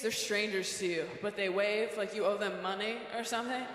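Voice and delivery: ordinary delivery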